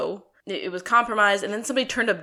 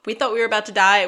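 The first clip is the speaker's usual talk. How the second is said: louder, fast, breathless rush of words; frantic relief; 8 dB louder, faster than usual. Retold or imitated. Reunited bandmates admit they nearly died, fast and loud. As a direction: blurt it like you barely survived. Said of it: "they were like".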